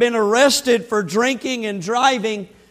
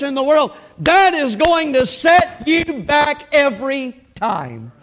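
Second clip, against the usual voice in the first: raised, driving pulpit voice, louder than the storytelling; fervent preaching; 5 semitones higher, 3 dB louder than usual. Acted. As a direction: preach it out, pressing the point home. Preaching builds to full force.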